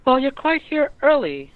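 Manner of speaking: put-on high, bright girlish voice, loud; girl's voice. GM voicing the girl greeting the party early. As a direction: play a sly young girl calling from everywhere. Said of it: "the girl's voice".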